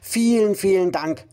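high, bubbly, giddy thanks; exuberant thanks. Acted. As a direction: gush with high giddy joy. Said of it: "blubberte der kleine blaue Fisch übermütig".

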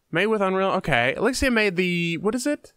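usual speaking voice

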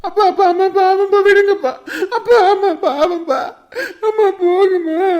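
put-on child pleading through tears to stop the fight; child begging father not to hit mother; crying plea.

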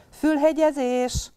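high, slow sing-song call; mama bunny calling the ear-pricking game. Acted. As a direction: stretch it out, calling across the meadow. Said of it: "kiáltotta most Nyuszi mama".

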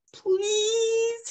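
put-on very high, long drawn-out childish please; compelling plea. Grandson begging grandma, very high pleading. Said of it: "he compels".

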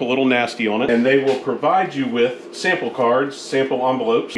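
ordinary talk, nothing marked

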